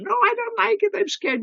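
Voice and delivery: high-pitched frightened squeal, all the way up; scared scream. Retold or imitated. Friend refusing to hold the snake, high scared squeal. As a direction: squeal it like someone terrified of the snake. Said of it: "She would scream".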